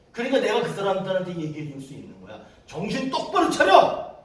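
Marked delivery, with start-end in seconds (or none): 2.7-4.1 high, loud, slowed command; forceful shout. Retold or imitated. Preacher jolting the missionaries awake, forceful shout. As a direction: yell it to shock them awake. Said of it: "눈이 동그래져".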